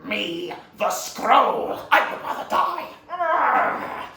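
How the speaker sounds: slow demand climbing into a defiant cry; fierce demand. Acted. Villain demands scroll from fallen master, fierce. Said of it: "He goes".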